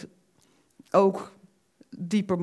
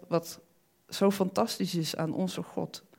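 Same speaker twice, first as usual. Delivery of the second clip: normal talking voice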